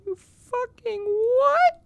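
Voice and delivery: very high, drawn-out squeak of disbelief; incredulous outrage. Real, not acted. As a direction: squeal it slowly, unable to believe it. Incredulous at teammate or game.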